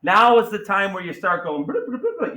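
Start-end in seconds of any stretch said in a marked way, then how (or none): 1.6-2.4 high mock computer blips; computer brain beeping. Teacher mimics a student's brain crunching numbers, high beeping. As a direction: chirp it like a little computer thinking. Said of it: "your computer brain".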